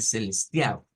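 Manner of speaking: "celestial" said as celes-tiau; final L as U. Shown as a demonstration. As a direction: turn the L of "celestial" into U. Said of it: "se cambia la L por una U".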